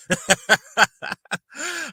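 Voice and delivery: loud, high burst of laughter. Real, not acted. Vlogger laughing at Joshua's excuse, loud laugh. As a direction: crack up at the claim.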